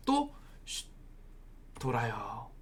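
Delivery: hushed, low voice after a shush; quiet as if sneaking round; hushing. Acted. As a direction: shush them, then speak very softly. Marching round the wall in silence.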